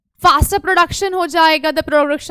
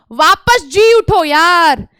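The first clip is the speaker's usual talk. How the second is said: hammed-up revival; 4 semitones higher, 9 dB louder, slower than usual. Acting out getting refreshed.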